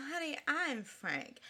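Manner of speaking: slow, soft, sultry sass; sassy Honey. Retold or imitated. Lady Chablis calmly setting him straight, slow sultry. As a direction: purr it out coolly. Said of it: "she just plays out".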